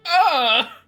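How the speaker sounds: high loud yelp; mock pained yelp. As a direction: yelp out as if hit. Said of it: "He's like".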